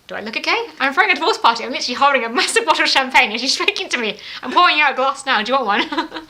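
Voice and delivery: loud, laughing, high party cheer; exuberant comeback. Retold or imitated. Divorcee answers a pitying guest, loud and gleeful. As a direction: throw it back with champagne glee.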